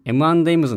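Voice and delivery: エムアンドエムズを英語式に「エメネムズ」のようにつなげて; 英語発音. Shown as a demonstration. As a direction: say エムアンドエムズ as native English "em-en-EMS", run together. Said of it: "ちなみにエムアンドエムズの発音は". (エムアンドエムズを日本語読みせず英語の音で)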